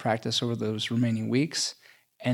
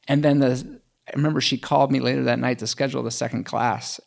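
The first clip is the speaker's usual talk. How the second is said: normal talking voice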